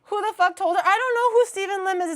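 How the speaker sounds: loud, high indignant outburst. Real, not acted. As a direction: protest loudly at chat. Outraged at chat.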